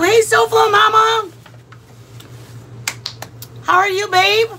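excited greeting. Real, not acted. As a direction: greet her warmly and excitedly. Greeting a live viewer.

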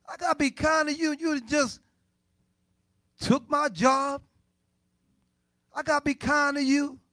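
high, loud, offended complaining; indignant church member. Acted. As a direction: play the wronged churchgoer who won't be kind. Voices a grudging congregant.

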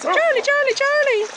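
high, loud call across the water; calling the dog's name out. Real, not acted. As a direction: call him back, bright and loud. Calling the dog in the river.

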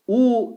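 teacher models the thick U for students, long and clear; thick U sound. Shown as a demonstration. Quran teacher demonstrates thick vowel U, drawn out. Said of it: "Ötreye gelince ötre kalın".

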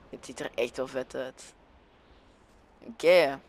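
usual speaking voice

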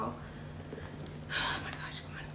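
drawn out breathless whisper of suspense; nervous whisper. Real, not acted. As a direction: whisper it very slowly, holding your breath. Nervous unboxing of the iPad.

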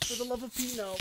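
whispered frantic no's; begging under the breath; hushed pleading. Real, not acted. Quiet desperate pleading in game.

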